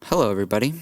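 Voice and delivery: smooth radio announcer hello. Shown as a demonstration. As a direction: greet them like a radio host. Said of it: "that radio voice going on".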